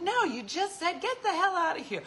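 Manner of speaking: mom's retort. Retold or imitated. Mom quoting dad's rude line back at him, high and indignant. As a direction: throw his words back at him, indignant.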